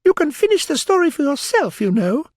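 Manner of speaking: very high, loud, huffy squeak; sulky Dormouse. A narrator's reading. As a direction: sulk in a little dormouse voice. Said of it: "the Dormouse sulkily remarked".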